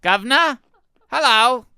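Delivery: high, loud stage Brit with a laugh; mock British accent. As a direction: offer tea like a cartoon Englishman. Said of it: "the U K".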